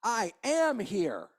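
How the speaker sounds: drawn out, each word weighted; slow emphatic I am here. Acted. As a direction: say it slowly and firmly, as a promise. Jesus voiced, slow emphatic.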